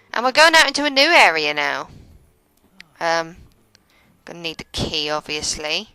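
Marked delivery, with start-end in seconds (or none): none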